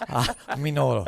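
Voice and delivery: mock Chinese accent, brief; hammed-up Chinese accent. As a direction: toss out a joke Chinese accent. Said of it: "you were gonna go Chinese accent".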